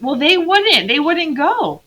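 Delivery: lightly mock the kid's excuse; teacher imitating Lucy's excuse; whiny excuse. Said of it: "she's blaming it on the others".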